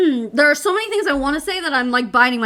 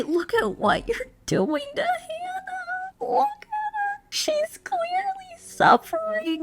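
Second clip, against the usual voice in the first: much higher, slow, hammed-up crying voice; mock sobbing plea; 5 dB quieter, slower than usual. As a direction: sob it out like an overdone guilt trip. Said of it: "Michelle should go to her son and say".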